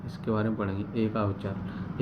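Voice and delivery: ordinary talk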